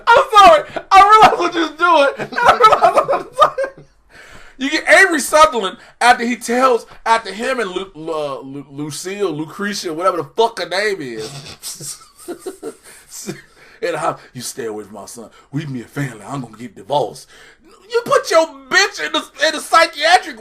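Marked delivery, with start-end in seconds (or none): 0.1-3.9 high, loud laughter through words; laughing apology. Real, not acted. Laughing at himself mid-rant. 14.3-17.3 much lower, softer swamp monster; low mumbling Swamp Thing. Retold or imitated. Character voiced in recap.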